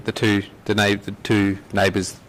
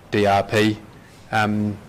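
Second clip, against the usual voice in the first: ordinary talk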